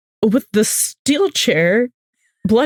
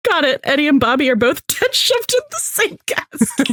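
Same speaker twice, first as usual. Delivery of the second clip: laughing quote; 6 semitones higher than usual. Retold or imitated. Retelling dark joke, laughing.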